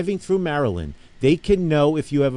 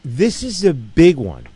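louder and slower, leaning into it; enthusiastic; 3 semitones higher, 7 dB louder, slower than usual. Real, not acted. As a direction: build real excitement over the big news. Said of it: "I get over-enthusiastic about stuff like this".

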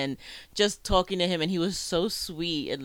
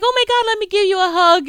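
high, loud, thrilled squeal; gushing excitement; 12 dB louder than usual. Retold or imitated. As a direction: squeal it like meeting your idol. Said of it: "And it's like".

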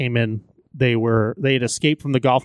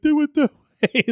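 much higher, slower mock yell; swimming bikers; 12 semitones higher than usual. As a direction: squeak it out slowly from the water. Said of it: "they're like".

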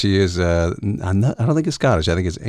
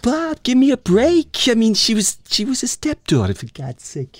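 Woody Allen impression; 12 semitones higher than usual. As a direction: play a nervous whiny Woody Allen.

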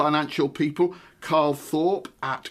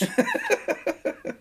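high laugh. Real, not acted. Laughing at own accent attempt.

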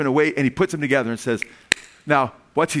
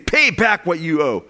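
slow, forceful, raised-voice demand; angry demand; 2 semitones higher, 4 dB louder, slower than usual. A narrator's reading. Creditor choking his fellow slave, angry demand. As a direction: demand it hard, stretching the words. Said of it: "seized him and began to choke him".

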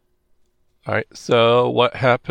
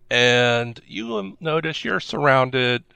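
usual speaking voice

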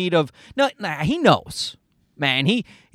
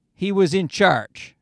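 ordinary delivery

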